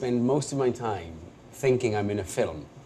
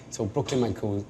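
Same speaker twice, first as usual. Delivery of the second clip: normal talking voice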